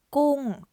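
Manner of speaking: gung loud, starting high and falling; Thai falling tone. Shown as a demonstration. Teacher modelling gung with a falling tone. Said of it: "falling tone in the first word".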